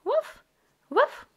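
high little puppy woof; puppy bark. Acted. Voicing the puppy Biscuit barking.

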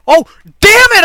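high, loud curse; frustrated shout. Real, not acted. Gamer nearly made the jump, frustrated shout. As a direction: burst out at a near miss. Was about to make the jump.

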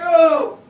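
loud, high mock wail; hammed-up bawling cry; blubbering wail. Preacher convicted by God, imitated blubbering wail. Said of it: "I'm just this blubbering snot blob".

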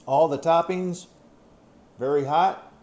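talking while chewing; eating off a fork; mouth full. Real, not acted. Seen 0: Fork of pizza held at open mouth throughout the labelled words.

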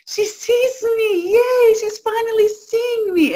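put-on a little kid cheering that mom finally sees them; overjoyed child. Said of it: "your child is like".

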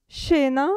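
Scena begun with sh, shena. Shown as a demonstration. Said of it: "che si pronuncia sce". (Scena con sc come sci, shena)

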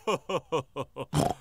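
short amused chuckle; a little laugh at the dusty box; light chuckle. Acted. Daddy laughs about dusty instruments, chuckling.

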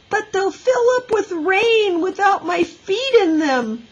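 put-on high, whining child's protest. A narrator's reading. Little girl argues with teacher over boots, protesting. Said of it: "protested Ramona".

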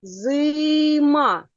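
Зима with a long, sustained И; second sound drawn out. Shown as a demonstration. Teacher cueing the second sound, И stretched in Зима. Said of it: "Який другий звук у слові зима?".

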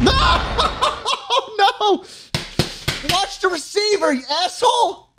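high cracking laughter-yell; laughing yell. Real, not acted. Gamer yelling at clumsy Stalin, laughing yell. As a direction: shriek it out mid-laugh. Avatar fumbles the phone again.

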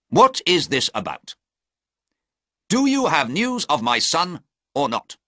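a duke losing patience, pressing hard; angry. A narrator's reading. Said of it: "the Duke said angrily".

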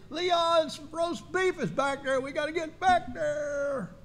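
high, slow, drawn-out longing; mock eager churchgoer. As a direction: moan about the roast beef. Congregation eyeing the food.

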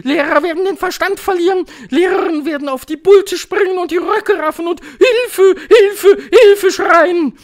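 high, loud, racing witch voice; piercing excited proclamation; commanding Grand High Witch. A narrator's reading. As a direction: build to shouted cries for help. Grand High Witch foretells chaos.

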